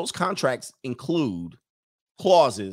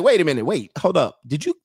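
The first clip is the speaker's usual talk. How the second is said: mock double take; 5 semitones higher, 3 dB louder than usual. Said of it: "You be like".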